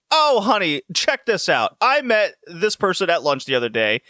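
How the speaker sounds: high chatty mock mother; imitating mom. Mother calling Kate from the factory, high chatty mock voice. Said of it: "and she's like".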